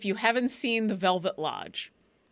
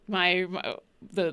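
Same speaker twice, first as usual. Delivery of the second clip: usual speaking voice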